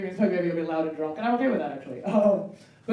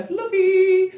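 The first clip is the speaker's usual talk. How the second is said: slow, higher, louder gushing; gushing fluffy; 10 semitones higher, 7 dB louder than usual. Acted. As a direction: stretch it out, savoring.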